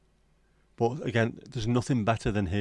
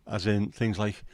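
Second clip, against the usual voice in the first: ordinary talk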